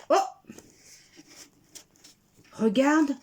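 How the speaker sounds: usual speaking voice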